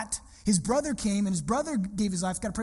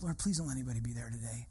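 quick, much softer plea under the breath; hushed prayer; 11 semitones lower, 10 dB quieter, faster than usual. Retold or imitated. Pastor retelling his nervous prayer on the way, hushed and quick. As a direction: pray it low and fast, hoping nobody shows. Silent prayer walking to campus.

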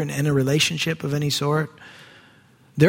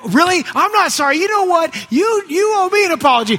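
much higher and louder, mock-offended; indignant outburst; 12 semitones higher, 4 dB louder than usual. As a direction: flare up when forgiven instead of forgiven back. Apology goes wrong, outburst.

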